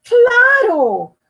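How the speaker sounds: high loud joyful cheer; father delighted with his son. Acted. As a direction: burst out proudly.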